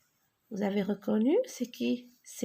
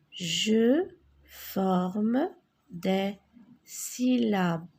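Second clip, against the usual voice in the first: slow instruction; much slower than usual. Shown as a demonstration. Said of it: "teacher models slow reading".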